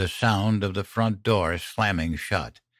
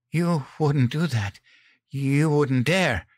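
higher, frightened plea, slowing at the end; whimpering; 6 semitones higher than usual. A narrator's reading. Mother fears for her son, higher slowing whimper. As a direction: draw out the last words in fearful disbelief. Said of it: "Mom whimpered".

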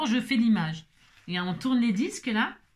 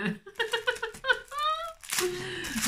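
high laugh; 13 semitones higher, 3 dB quieter than usual. Real, not acted. Laughs after singing.

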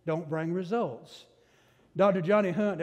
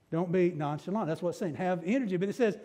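normal talking voice

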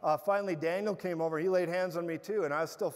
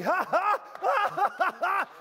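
loud, high forced ha-ha bursts; fake laugh; 13 semitones higher, 7 dB louder than usual. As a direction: force out a mocking laugh at the pain. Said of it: "That sounds like a fake laugh".

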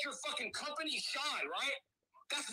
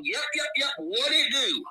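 loud mocking catchphrase; Ryback; 8 dB louder than usual. Host ridiculing the guest's wrestling act, loud mock impression. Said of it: "the best acting that you could come up with".

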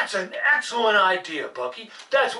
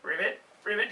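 soft frog croak; 9 dB quieter, faster than usual. Acted. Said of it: "He's gonna croak".

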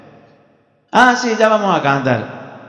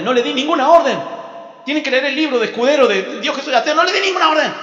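high exasperated protest, rising at the end; indignant denial; 9 semitones higher than usual. Acted. God telling the angel he gave no order, indignant.

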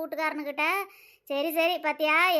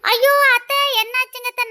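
much higher, louder panicked wail; alarmed wail; 9 semitones higher, 11 dB louder than usual. Acted. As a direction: rush in frightened for her. Bride alarmed seeing sick mother-in-law.

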